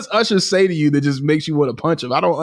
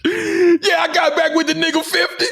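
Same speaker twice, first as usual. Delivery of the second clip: mock rapper hype; 13 semitones higher than usual. Mimicking rapper's reunion announcement.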